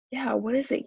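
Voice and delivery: plain reading voice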